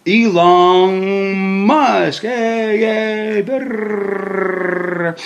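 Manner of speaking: drawn-out, high, loud name announcement; hype announcer intro. Pretend. Host introducing the richest dude in the world, hype announcer. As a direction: stretch out the guest's name like a hype man, then trill.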